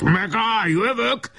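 high, strained threat without extra volume; angry roar. A narrator's reading. Sand-spattered Pásztor threatens his attacker, high angry cry. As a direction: cry out a furious warning. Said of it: "ordította haragosan".

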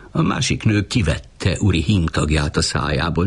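plain reading voice

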